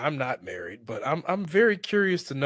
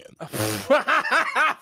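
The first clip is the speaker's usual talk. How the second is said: high, loud burst of laughing; laughter; 12 semitones higher, 5 dB louder than usual. Real, not acted. Laughing at the host's question.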